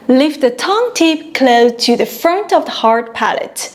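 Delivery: normal talking voice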